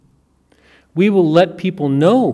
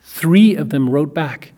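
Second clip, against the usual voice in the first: usual speaking voice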